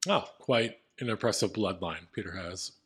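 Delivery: ordinary delivery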